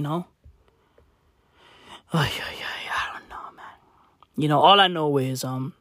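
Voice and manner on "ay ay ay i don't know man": whisper. Real, not acted. Muttered aside.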